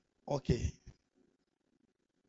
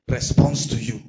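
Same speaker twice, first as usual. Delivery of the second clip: loud, emphatic call to repeat; prayer declaration; 2 semitones lower, 10 dB louder, slower than usual. Real, not acted. Preacher leads congregation's thanks to Father, loud declaration. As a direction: declare it boldly for them to echo.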